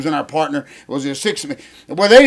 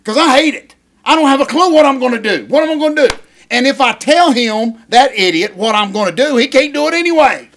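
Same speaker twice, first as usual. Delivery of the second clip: real exasperation, pitched high; worked-up rant; 5 semitones higher than usual. Real, not acted. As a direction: rant like your head's about to explode. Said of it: "My head's about to explode".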